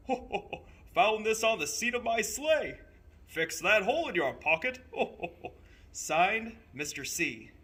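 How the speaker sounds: put-on a chuckling Santa writing to the boy. A narrator's reading. Santa's note to the boy, jolly and chuckling.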